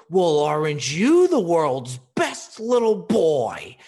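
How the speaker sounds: slow, loud jolly Santa voice hiding anger; sugary Santa voice barely holding back anger. Retold or imitated. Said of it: "maintains the Santa voice".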